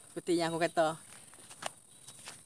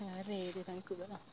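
quiet; 13 dB quieter, slower than usual. Real, not acted. Laughing quietly after losses.

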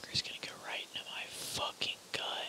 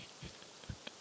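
soft laugh; 13 dB quieter than usual. Real, not acted. Laughing during ASMR.

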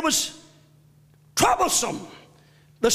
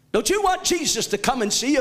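ordinary talk, nothing marked

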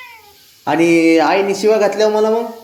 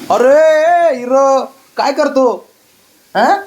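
high, loud, drawn-out call to the pet; excited call; 8 semitones higher, 4 dB louder, much slower than usual. Real, not acted. Calling out to the pet bird hero, a high, slow, excited hail. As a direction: sing out to the bird with delight.

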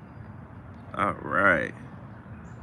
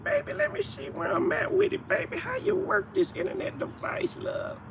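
much higher, sweet, fumbling put-on voice; phone newbie; 7 dB quieter than usual. Mocking a person new to the internet, high sweet voice. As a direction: play someone lost with a new phone. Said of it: "the person that's new to the phone".

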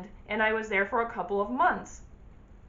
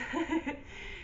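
soft breathy laugh; 5 semitones higher, 8 dB quieter than usual. Real, not acted. After the story.

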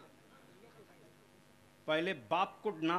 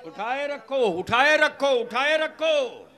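loud, raised, drawn-out command; calling out; 6 semitones higher, 12 dB louder, slower than usual. Real, not acted. Preacher telling the crowd to keep hands raised, loud calling out. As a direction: call it insistently over the crowd.

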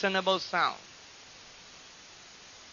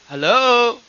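raised, drawn-out hello; loud hello; 3 semitones higher, 8 dB louder than usual. Shown as a demonstration. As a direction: call hello for others to repeat. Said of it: "Can you say".

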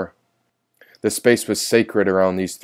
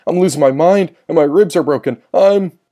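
high, loud desperate outcry; Levi; 8 semitones higher, 5 dB louder than usual. A narrator's reading. Injured brother crying out from his cell, high loud desperation. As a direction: cry out in pain and rising panic. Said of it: "heard my dearest brother cry out".

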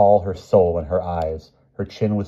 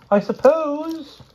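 doubtful; 3 dB louder, much slower than usual. A narrator's reading. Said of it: "he said doubtfully".

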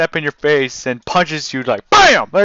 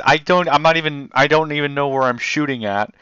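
ordinary talk

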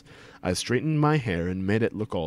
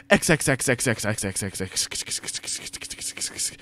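rattled-off divider; faster than usual. A narrator's reading.

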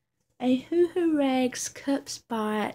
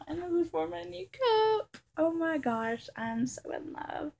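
close to tears; 8 semitones higher, 3 dB quieter than usual. Real, not acted. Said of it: "I'm about to cry".

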